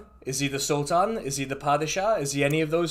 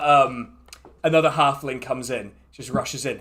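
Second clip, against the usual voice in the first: ordinary talk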